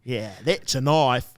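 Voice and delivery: slow, drawling Aussie Crocodile Dundee; lightly voiced Dundee, slow. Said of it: "Crocodile Dundee".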